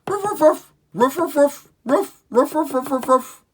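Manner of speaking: very high, yappy ruffs; dog bark. Pretend. Showing kids what dogs say, high loud yapping. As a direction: yap like a small excited dog. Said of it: "The dog says".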